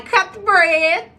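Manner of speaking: mom's way of talking. Retold or imitated. Woman quoting her mama's language, drawn-out and high. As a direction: stretch it out like mom would. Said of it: "what my mom would say".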